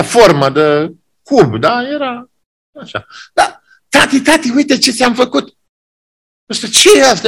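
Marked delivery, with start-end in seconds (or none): 3.3-5.6 put-on excited child. Child offers gift to father. 6.7-7.3 sharp, high irritated bark; annoyed father. Acted. An angry father snaps at his son's gift.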